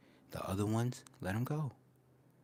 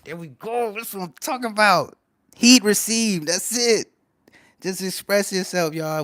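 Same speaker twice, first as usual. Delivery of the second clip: higher and louder, pumped; hyped up; 11 semitones higher, 12 dB louder than usual. Real, not acted. To the chat, pumped and loud. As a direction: shout it like a hype man.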